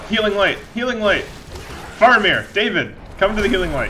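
high, loud urgent calling. Real, not acted. Calling David to the healing light, loud high. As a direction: summon your ally across the battle.

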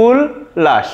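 উল্লাস said low as "ul-lash" with a doubled l; correct pronunciation. Shown as a demonstration. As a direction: model the word উল্লাস as "ul-lash", falling. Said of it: "সঠিক উচ্চারণ হয়ে যাবে".

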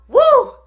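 high, loud "woo"; sudden high-pitched exclamation; gossipy whoop. Real, not acted. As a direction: whoop at juicy scandal. Exclaiming over the scandalous gossip.

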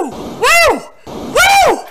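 very high falsetto "woo"; loud high whoops, one after another; excited whoops. Real, not acted. Player cheering on the game, excited falsetto whoops.

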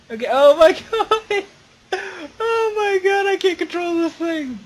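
high, loud laughing panic; panicked excited yelling. Real, not acted. Gamer losing control of his truck: high laughing panic. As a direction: shriek with real thrill as the truck spins.

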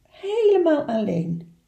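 plain reading voice

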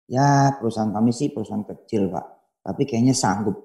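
soft self-belittling; soft, meek humble bid; humble mumble. Retold or imitated. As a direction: say it small and meek. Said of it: "Nggak perlu juga kita ngomong".

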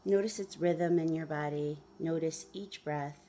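measured meditation guide with long pauses; guided meditation. Acted. Guide asking listeners to follow the breath, guided meditation. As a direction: lead them gently through it, unhurried.

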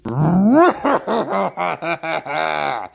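long, triumphant evil laugh; villain laugh. Acted. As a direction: cackle as the attack lands. Villain reveals attack.

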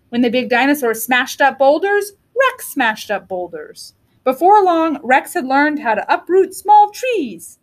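ordinary delivery, nothing marked

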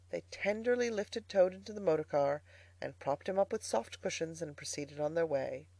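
ordinary delivery

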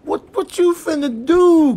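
usual speaking voice

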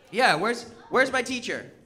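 ordinary talk

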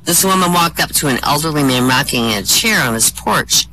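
usual speaking voice

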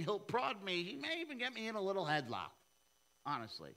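usual speaking voice

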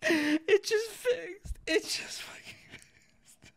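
high, slow whisper through a laugh; whispering in disbelief it worked; stunned whisper. Real, not acted.

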